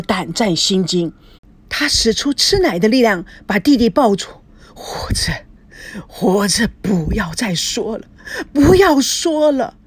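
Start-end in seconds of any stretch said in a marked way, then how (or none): 4.8-9.8 breathy, tearful plea that breaks upward; distressed pleading. Acted. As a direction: beg him to stop, stretching the last words. Frightened brother restraining sobbing sibling.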